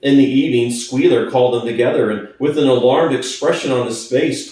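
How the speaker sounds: usual speaking voice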